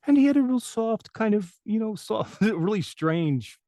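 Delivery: imitating a strange high-pitched voice; Winnie the Pooh impression. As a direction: lift it into a strange, high character voice. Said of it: "it sounded like Winnie the Pooh".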